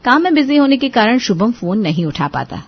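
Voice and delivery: ordinary delivery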